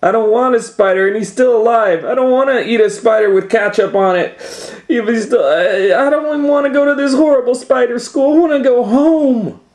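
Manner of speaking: high, loud, fast panicked scream. Acted. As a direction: scream in panic like a frightened child. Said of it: "And Kate screamed".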